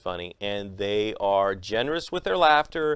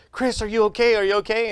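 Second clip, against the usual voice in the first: high, loud alarmed teacher; worried teacher; 11 semitones higher, 5 dB louder, faster than usual. Retold or imitated. Teacher calling to Chris after his fall, high alarmed. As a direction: call out in sudden worry.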